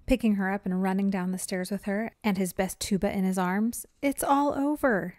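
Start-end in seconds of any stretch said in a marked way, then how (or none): 4.0-5.1 slow, higher mock shout. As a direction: call it out in panic while fleeing. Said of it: "shouted her brother".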